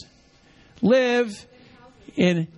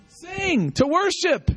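ordinary talk, nothing marked

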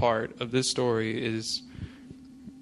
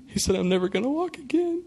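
much higher, put-on woeful voice, hammed up; mock tragic quote; 12 semitones higher than usual. To his friend, faking bad news in a high mock-tragic voice. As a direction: fake the doom to fool your friend. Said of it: "Like that".

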